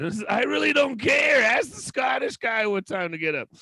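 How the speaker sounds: fake-snap at the comic; mock rant.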